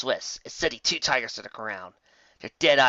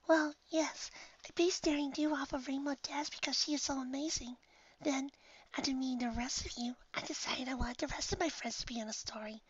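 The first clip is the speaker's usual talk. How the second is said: soft, high breathy whisper; timid hushed confession; shy whisper; 12 semitones higher, 13 dB quieter than usual. Real, not acted. Shy speaker all soft.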